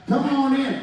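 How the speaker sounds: loud, high welcoming call; calling someone in. Retold or imitated. Man at the gate letting a newcomer in, calling out high and loud. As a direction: call him in, pitched well up.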